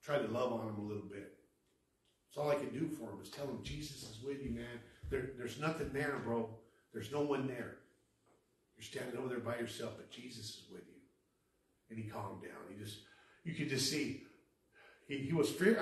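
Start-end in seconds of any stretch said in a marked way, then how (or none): 3.6-11.0 soft, hushed comforting voice; gentle reassurance. Retold or imitated. Speaker telling the man nobody is there, soft reassurance. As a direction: talk someone down quietly, fading at the end.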